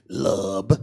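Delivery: "love" said as "lub", very low; deep, drawn "lub" for "love"; mock mispronunciation. Shown as a demonstration. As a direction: drop low and end "love" on a b. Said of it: "L U B".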